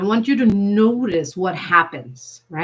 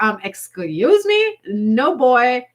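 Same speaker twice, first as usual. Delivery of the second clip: higher, louder sassy pushback; sassy refusal; 6 semitones higher, 5 dB louder, slower than usual. Pretend. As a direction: brush him off with attitude. Refusing the streaking dare.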